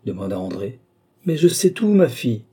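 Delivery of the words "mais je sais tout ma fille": loud, high, insistent outburst. A narrator's reading. Father confronting his daughter about her secret, loudly insistent. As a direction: press the point forcefully, voice jumping up.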